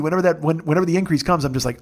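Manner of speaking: ordinary delivery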